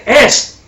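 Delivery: the single word es, loud and isolated; stress on es. Shown as a demonstration. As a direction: hit the word es hard, alone. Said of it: "Usted no puede decir".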